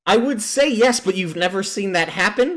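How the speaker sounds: high, loud, laughing answer; real laughing. Real, not acted. Game master teasing a forgetful player, laughing.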